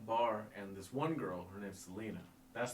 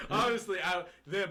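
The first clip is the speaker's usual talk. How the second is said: usual speaking voice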